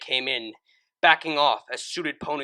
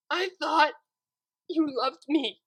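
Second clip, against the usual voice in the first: heartbroken; 4 dB louder than usual. Acted. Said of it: "couldn't see for the sadness".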